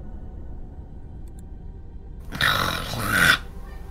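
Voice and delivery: long, voiceless exhale; long sigh. Real, not acted. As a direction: breathe out a drawn-out sigh.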